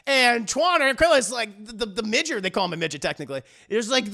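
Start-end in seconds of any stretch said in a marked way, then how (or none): none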